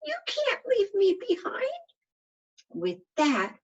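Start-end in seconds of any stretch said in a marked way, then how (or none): 0.0-1.7 tiny, much higher whiny voice; a teeny dot pleading not to be left; whiny little voice. Acted. The dot crying out to the letters, in a tiny whiny voice. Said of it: "whiny voice cried out".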